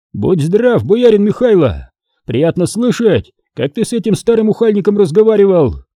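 high, hearty greeting; Foma greeting the boyar. A narrator's reading. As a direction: salute the boyar with open approval.

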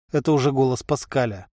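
usual speaking voice

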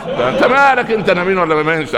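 high, playful brush-off; teasing retort. Retold or imitated. Sheikh brushes off the passenger's question, teasing and high. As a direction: tease him with a grin in the voice.

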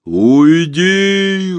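slow, drawn-out booming creature call; muffled monster voice. A narrator's reading. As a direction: loom over the forest with a hollow voice. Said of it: "раздался голос в ответ".

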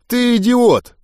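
high, scornful verdict; contemptuous. A narrator's reading. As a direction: pitch it up with open contempt. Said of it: "презрительно фыркнув". (презрительно, высоким голосом)

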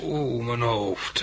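slow, groggy moan about the head; hurting, barely awake; dazed and in pain. Acted. Waking with head injury. (kreunend van hoofdpijn)

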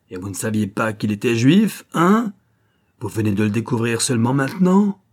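accusing questions shooting up at the end; angry sarcasm. Acted. Officer confronting Louis over the corpse, menacing sarcasm.

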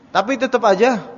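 ordinary talk

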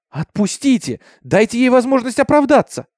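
shouted demand. A narrator's reading. Jack defends Minty before the crowd, urgent high shout. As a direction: cry out for fairness, pitch climbing. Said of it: "закричал Джек".

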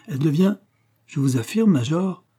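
a touch of acid; slightly sour. A narrator's reading. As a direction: cut in a little sourly, voice lifted. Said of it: "l'interrompant un peu aigrement".

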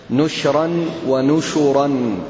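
slow, نشرا first with a short u and silent sh (nushran), then with a vowel after the sh (nushuran); two readings spelled out. Shown as a demonstration. Teacher demonstrating the two Quran readings to students, slow and careful. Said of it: "بضم النون وإسكان الشين، أو بضم النون والشين".

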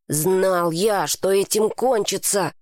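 ordinary delivery, nothing marked

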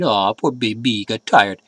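put-on slow, sarcastic baby-talk pity; drawn-out mocking "aw"; mock sympathy. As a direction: coo with fake pity for a pampered client. Mocks the tired rich client.

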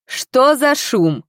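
official's drawn-out irate challenge; angry demand. A narrator's reading. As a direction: demand an answer with authority. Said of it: "послышался гневный голос градничего".

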